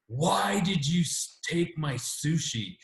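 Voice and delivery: put-on high, slow, whining kid voice; whiny daughter. Husband imitating his daughter interrupting his event, high and slow whine. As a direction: drag it out like an upset child. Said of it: "my daughter comes up and totally interrupts and goes".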